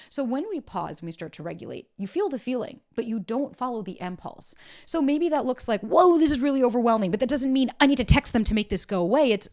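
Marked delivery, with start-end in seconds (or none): 5.8-7.1 overwhelmed exclaim. Pretend. Voicing an overwhelmed inner thought.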